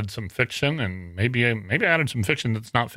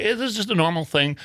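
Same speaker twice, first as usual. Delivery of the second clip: high, poorly imitated Southern lady; reassuring in a put-on Southern voice; Southern accent; 8 semitones higher than usual. Copyright office lady reassures caller, mock Southern accent. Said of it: "Southern accent".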